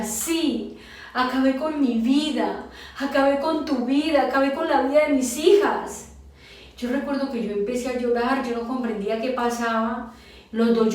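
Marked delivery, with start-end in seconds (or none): 0.0-6.1 insistent self-blame, rising and getting louder; retold tearful confession. Husband admitting to his wife he ruined everything, anguished. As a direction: imitate an anguished husband, higher voice. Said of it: "él lloraba y me decía".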